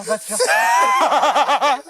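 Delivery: loud, laughing outburst; laughing shout. Real, not acted. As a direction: shout it while cracking up. Laughing at the ending.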